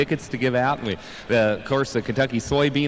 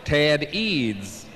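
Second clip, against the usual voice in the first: tractor pull announcer; 2 semitones higher than usual. Acted. Co-announcer reading driver intros.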